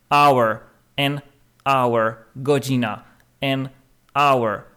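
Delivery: "an hour" said with silent H, a linked to "awer"; linking "an hour". Shown as a demonstration. Teacher drilling pupils on "an hour", no H. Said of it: "to powiemy an".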